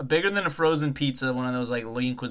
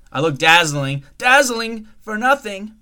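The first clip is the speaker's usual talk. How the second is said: vain diva; 8 semitones higher, 9 dB louder than usual. Pretend. Vain self-praise about makeup.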